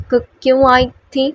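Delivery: a teasing copy of her stammer; officer mocking her stammer; mock stutter. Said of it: "اسی کی tone میں کہا".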